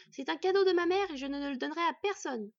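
loud, high, defiant outburst; crying out. A narrator's reading. Boy protects his mother's gift, crying out. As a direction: cry it out high and forceful. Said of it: "s'écria le garçon".

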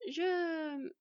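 begin, unsure what to say; reluctant, hanging hesitation; hesitant. A narrator's reading. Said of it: "La louve sous forme humanoïde hésita".

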